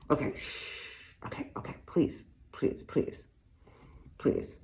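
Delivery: urgent whisper, please please please; whispered pleading. Acted. Shopper begging the clerk once someone walks in, whispering. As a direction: hush and beg so no one hears.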